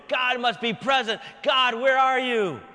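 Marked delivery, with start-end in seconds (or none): 1.4-2.6 calling out. Acted. Elijah calling out for God.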